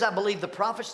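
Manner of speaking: Agrippa's imagined confession. Shown as a demonstration. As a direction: blurt it out. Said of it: "louder variant".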